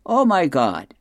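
a hammed-up yelp of fright; mock yell. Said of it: "he yells out".

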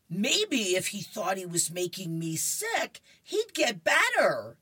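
rising to a high, loud finish; comic-strip indignation, building to the end; indignant cartoon girl. Voicing indignant Lucy in comic.

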